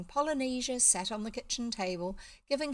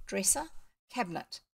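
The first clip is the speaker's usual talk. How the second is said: plain reading voice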